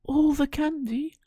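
very high, loud, rising squeal; a kid dazzled by candy; thrilled disbelief. Acted. Pinocchio thrilled by the coachman's promise of candy, very high.

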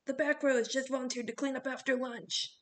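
stern coach projecting to the back; coach yelling. A narrator's reading. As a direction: call out a punishment to the whole bus. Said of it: "Coach Hedge yelled from the front".

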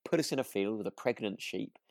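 ordinary talk, nothing marked

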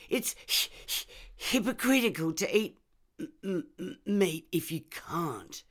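high stammered reasoning; stammering. Acted. Reb stammers.

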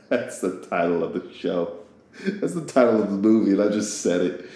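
talking through laughter; amused at your own slip, laugh as you say it. Real, not acted. Speaker catching their own silly movie-title slip, laughing.

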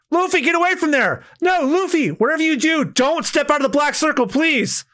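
high desperate calling out. Acted. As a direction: cry out high, pleading him to stay put. Princess calling out warning.

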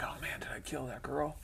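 near-whispered worried thought; soft, breath-only mock panic; hushed retold panic. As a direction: whisper it to yourself, retelling the dread. Panicked thought back in class.

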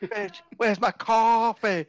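high, slow, haughty demand; bossy diva voice. Pretend. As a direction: play a spoiled diva barking an order.

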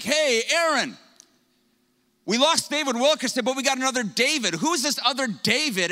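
lightly voiced excited acquaintance; acting out an eager man. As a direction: play the excited guy walking up. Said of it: "he's like".

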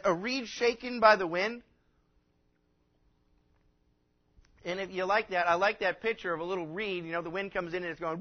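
loud, rising full-force preaching; fiery preaching. Acted. Preacher thundering Jesus' words about John to the congregation, loud and fervent. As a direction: preach it out to the whole room, building.

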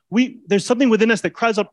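ordinary delivery

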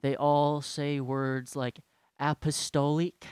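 slow, drawn-out sermon drone; ponderous, lingering church-speaker delivery; droning church elder. Retold or imitated. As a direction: parody an elder droning through a talk. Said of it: "They all sound like this".

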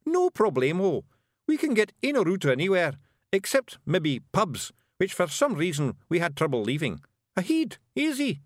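high Scots nonchalance; breezy Scottish Feegle. A narrator's reading. Rob assuring Miss Level that heads are easy, breezy. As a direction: boast casually, pitched well up in Scots. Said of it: "as if that sort of thing happened every day".

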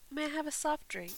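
lightly mock posh request; fancy posh voice. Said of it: "That's very fancy".

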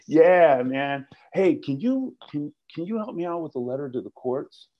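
high bro voice asking for help; AA buddy asking favor. Retold or imitated. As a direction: wheedle a favor like a pal. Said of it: "somebody's gonna come up to you and go".

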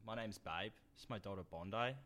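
put-on Australian accent. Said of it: "when I'm doing my Aussie".

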